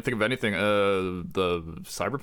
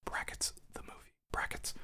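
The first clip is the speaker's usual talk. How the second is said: brackets whispered like a stage aside; 15 dB quieter than usual. Pretend. Whispering brackets to the audience, unvoiced. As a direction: breathe the brackets as a hushed aside. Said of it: "There's no brackets".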